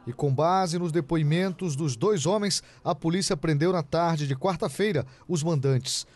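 crisp, emphatic TV reporter read; measured, punchy reporting delivery; news report narration. Acted. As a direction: read it as a crime news voice-over.

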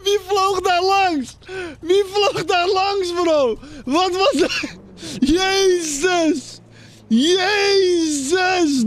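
high, slow disbelieving cries; astonished. Real, not acted. Streamer stunned by a man flying past.

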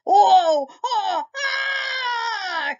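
very high crying wail; cute germ voice. Acted. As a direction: wail like a tiny germ down the drain. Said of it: "cried the germ".

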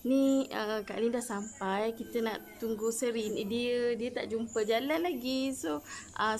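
normal talking voice

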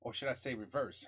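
ordinary talk

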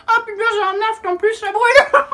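high, loud burning complaint; pained yelp. Real, not acted. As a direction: cry out as it stings. Mouth burning from alcohol.

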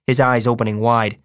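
plain reading voice

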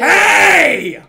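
high, loud shout of protest; sharp shout. Real, not acted. Player objecting to a teammate's insult, sharp shout. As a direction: shout it out to cut them off.

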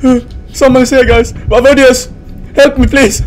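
high, urgent frightened voice; alarmed pleading. Real, not acted. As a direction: beg your companion for help in real fear. Hears sound, begs for help.

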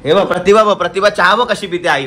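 husband teasing wife over spilled tea; teasing call. Real, not acted. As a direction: rib her about the spilled tea.

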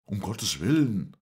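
loud, high exclamation; crying out. A narrator's reading. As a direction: call out to make someone stop. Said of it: "rief Montgomery". (laut ausgerufen)

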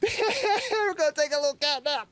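high, slow and giggly; laughing cutesy repeat. Acted. As his cat curls up to sleep, a slow laughing high coo. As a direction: savor each word through a laugh.

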